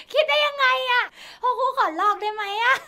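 high, loud laughing disbelief; giggly, high-pitched outburst; flustered squeal. Real, not acted. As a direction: cry out, delighted and embarrassed. Overcome by cheesy pickup line.

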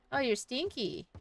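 normal talking voice

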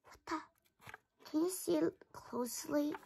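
unvoiced whispered what the; hushed puzzlement. Real, not acted. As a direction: whisper it under your breath. Whispering while zooming camera.

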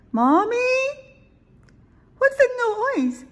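very high, loud little owl squeak. A narrator's reading. Little Owl hears a noise at bedtime, squeaking. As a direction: squeak the question like an alarmed small child. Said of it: "squeaked Little Owl".

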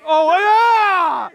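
high, wailing whoop; real shout of celebration; excited cheer. Real, not acted. Commentator cheering a lucky play, excited shout.

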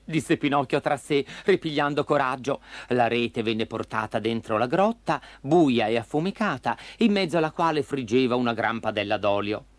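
ordinary delivery

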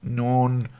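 high, drawn-out doctor voice; slow. A narrator's reading. Doctor weighing the priest's explanation, slow. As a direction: say it slowly, pitched high. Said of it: "sagte der Doktor langsam".

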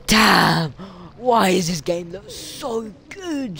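loud burst, then high, stretched-out awe; amazed outburst. Real, not acted. Player marvelling at how good the game looks, amazed. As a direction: exclaim loudly, then draw it out high.

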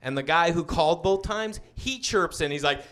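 ordinary talk

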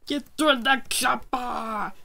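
loud, cracking cry to get to safety; hammed-up frantic cry; panicked yell. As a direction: shout it in a fake panic. Calling out while fleeing mobs.

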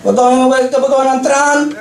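lightly voiced eager tourist; a hint of a giddy guy in yoga pants; excited European dude. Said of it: "He's like".

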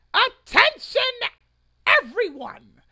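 a booming call for everyone's attention, high and loud; rally the crowd before the speech; town-crier style call to attention; loud announcement. Acted. Opening his public protest statement.

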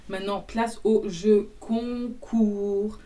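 slow, grand announcement; announcer voice. Acted. Introducing the giveaway to viewers, slow announcer voice. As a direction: build it up like a show host, slowly.

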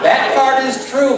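loud, slow insistence; emphatic shout. Real, not acted. Comic assuring audience it's true, shouting. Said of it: "insists to audience".

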